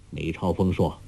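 plain reading voice

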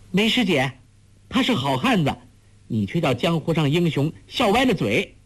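put-on high, quick-witted girl's protest; calling out. A narrator's reading. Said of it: "黄蓉叫道".

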